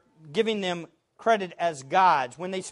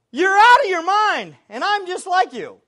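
high, raised-voice mock rebuke; acted incredulous outburst, pitched up; imitated exclamation; 9 semitones higher, 8 dB louder than usual. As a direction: exclaim it as Paul to a crowd. Acting Paul rebuking the crowd.

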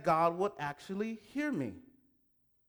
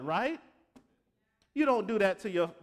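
usual speaking voice

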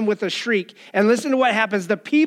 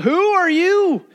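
crying out; 8 semitones higher than usual. Pretend. Pretend churchgoer crying out at the preacher. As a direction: cry out like a man possessed in church. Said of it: "walk into the church and cry out".

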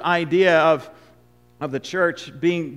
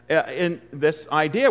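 ordinary delivery

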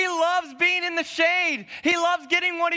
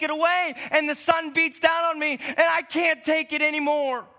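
higher, indignant rant of a fed-up prophet; angry Jonah; 3 semitones higher than usual. Acted. Jonah complains to God about the plant, indignant and raised. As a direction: vent every grievance at God.

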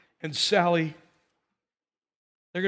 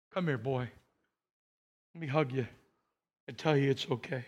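tender forgiveness; 5 semitones lower, 7 dB quieter than usual. Retold or imitated. Grandma forgiving Bobby tenderly.

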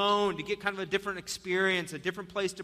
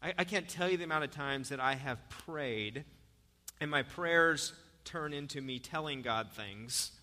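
normal talking voice

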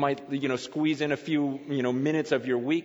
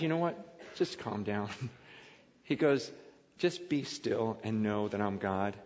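calm down, soft; 7 semitones lower, 7 dB quieter than usual. Pretend. God saying calm down.

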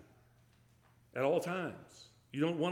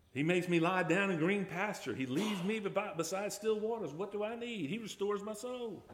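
higher, lifted reading of the psalm; psalm recitation; 7 semitones higher than usual. Acted. Preacher reciting Psalm 23 to his flock, lifted and higher. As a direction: recite it as scripture rising in hope.

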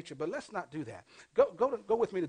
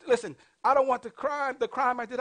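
ordinary talk, nothing marked

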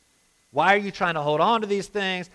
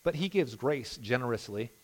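softer, savouring "But he gives grace generously"; quiet reread; 4 semitones lower, 7 dB quieter than usual. Shown as a demonstration. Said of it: "Just that little sentence there".

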